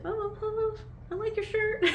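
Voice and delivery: high, laughing, nervous stranger's reply; hammed-up flustered person; awkward stranger. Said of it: "the person's like".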